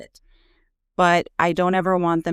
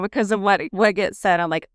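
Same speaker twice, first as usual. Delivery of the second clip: ordinary talk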